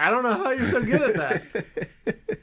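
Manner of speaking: laughing through the words; high giggly delight. Real, not acted. Laughing at friend's imitations.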